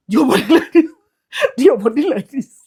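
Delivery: laughing through the words. Real, not acted. Showing viewers the walk, laughing. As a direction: let the laugh break in. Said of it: "I know I'm laughing".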